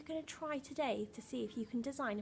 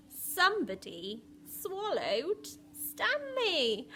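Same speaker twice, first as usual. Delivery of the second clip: put-on lively read-aloud for young children; storybook reading voice; story narration; 2 semitones higher, 8 dB louder, slower than usual. A narrator's reading. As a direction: tell the story to a class, bright and rhythmic. Reads picture book aloud.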